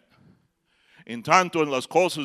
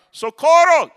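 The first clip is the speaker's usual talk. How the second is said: high loud yell of Socorro; short high shout; 13 semitones higher, 13 dB louder, slower than usual. Retold or imitated. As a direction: call Socorro out sharply. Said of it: "he escuchado a los mexicanos gritar".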